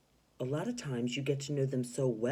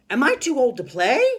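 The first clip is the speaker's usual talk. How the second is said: high, loud, cheery; wrong excited take; 11 semitones higher, 15 dB louder than usual. Shown as a demonstration. Teacher demonstrating the wrong way for worried Mister Potter. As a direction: sing it out brightly. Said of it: "he's not gonna say it like that".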